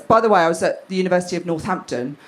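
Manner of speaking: ordinary talk, nothing marked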